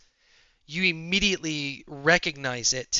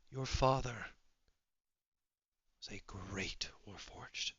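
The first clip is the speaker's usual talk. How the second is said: hushed, solemn character; 2 semitones lower, 8 dB quieter than usual. Acted. Character telling a Warforged about their father, hushed. Game master voices character answering player.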